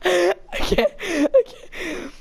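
laughing. Real, not acted. Laughing fit over burping.

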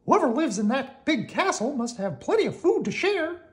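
high, loud cartoon call; rally the others in a high voice; eager Mickey. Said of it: "said Mickey".

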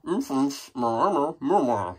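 mild muffled mouthful barking; mouthful of worms. Acted. Dog with a mouthful of worms, lightly muffled barks. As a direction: bark lightly through a full mouth. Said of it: "who has a mouthful of worms".

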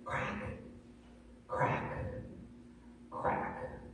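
whispered, spaced repetition; slow whispered crack. Acted. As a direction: hush each word like a breaking sound. Whispered repeated word for effect.

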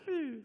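high faint machine hum; imitated dying hum. Kinect dying, imitated as a faint high hum. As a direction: mimic the Kinect giving out with a soft high hum. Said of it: "the little light on it just went".

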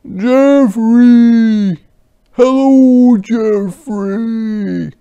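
high, loud calling out for a friend. Acted. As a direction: call his name across the yard. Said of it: "called Merton".

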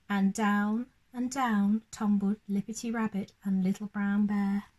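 put-on gentle storybook narration for children; lively read-aloud voice for little ones; bedtime story reading. A narrator's reading. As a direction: read it like a bedtime picture book.

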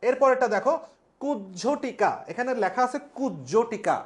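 ordinary talk, nothing marked

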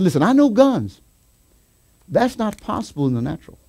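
ordinary talk

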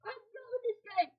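normal talking voice

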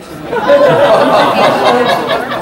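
a long, high airy laugh; high breathy laugh. Real, not acted. Laughing at the autocorrect prank story. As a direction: let the laugh burst out high and unvoiced.

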